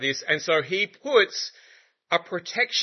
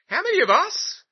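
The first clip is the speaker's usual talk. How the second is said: much higher, incredulous question; a soldier suddenly realising the odds; hammed-up bewildered soldier asking; mock soldier surprise; 14 semitones higher than usual. Soldiers marching, acted chatter.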